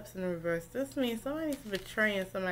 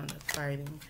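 ordinary delivery

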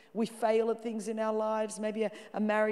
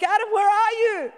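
loud, high call across the garden; 12 semitones higher, 11 dB louder than usual. Acted. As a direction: shout the name out like searching. God calling Adam in garden.

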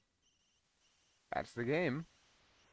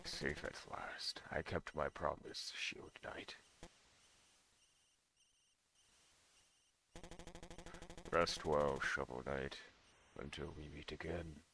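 hushed; 6 semitones lower, 11 dB quieter than usual. Acted. Reading the ending dialogue hushed.

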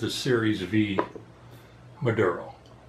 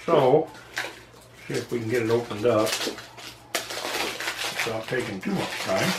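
talking around a cigar; cigar in mouth. Real, not acted. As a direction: lips clamped on cigar. Seen 2: Cigar clenched in mouth throughout while head bowed, lighting it.

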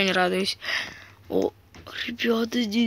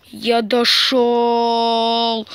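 slow drawn-out; 3 dB louder, much slower than usual. Real, not acted. Reached goal in game.

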